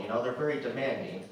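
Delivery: normal talking voice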